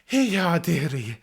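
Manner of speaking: high, loud flustered apologies; high flustered landlady; flustered innkeeper. Acted. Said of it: "and she goes".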